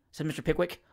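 usual speaking voice

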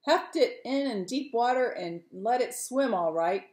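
high, exasperated shout at the men; indignant fisherman calling out. Acted. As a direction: call out a loud complaint. Fisherman shouts complaints from lookout.

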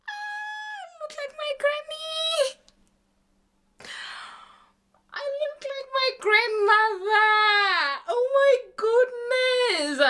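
real emotional cry, high and slow; tearful awe. Real, not acted. Moved by resemblance to granny.

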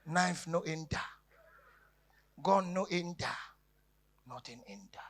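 usual speaking voice

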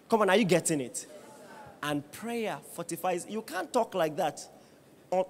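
normal talking voice

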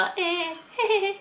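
high-pitched nervous little "hehehe"; awkward giggle. Retold or imitated. Girls caught by a stranger, high sheepish giggle. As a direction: squeak out a sheepish fake laugh.

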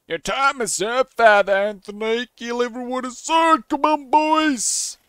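loud, rising yell to the gang; hammed-up outlaw shout; menacing bandit leader. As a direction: holler the order, drawing it out. Voices bandit leader's order.

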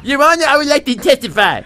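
mock courtroom plea with no upper lip; silly lisping voice. Comic asks judge in the silly voice. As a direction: lisp through a missing upper lip. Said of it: "use that voice in court".

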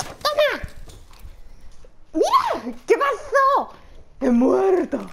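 high loud yells; loud high shouting from attack to death; frantic yelling. Real, not acted. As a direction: scream through the fight. Frantic fight, then dying.

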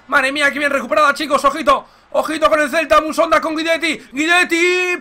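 breathless rising excitement; excited commentary. Real, not acted. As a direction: ride the attack as it builds. Attack building in game.

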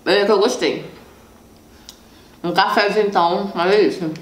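chewing between words; holding pastry while talking; mouth full of pastry. Real, not acted. Seen 4: bites pastry at -2.0, jaw chewing while speaking through 3.9.

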